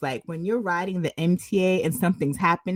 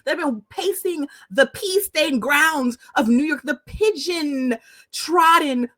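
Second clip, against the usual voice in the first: a mock scolding that builds higher and louder, into a shout; indignant rant; 7 semitones higher, 3 dB louder than usual. Retelling a guy telling off a girl on the train, building mock scold. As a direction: scold her about her dirty shoes, getting louder. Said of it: "He was like".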